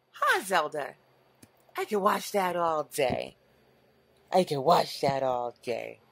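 slack jawed dopey dog voice; deep dopey dog voice. Pretend. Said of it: "extremely deep, dopey voices".

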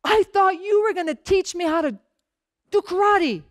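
mock frustrated teen complaint. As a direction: whine it like a fed-up student. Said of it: "Daniel is frustrated and he's like".

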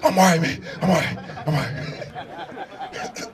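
low, breathless reassurance trailing into gasps; mock panicked panting; hyperventilating. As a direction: answer while struggling for breath. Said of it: "so I'm hyperventilating".

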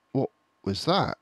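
mildly startled fox. Acted. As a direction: catch a noise. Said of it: "turned his head sharply".

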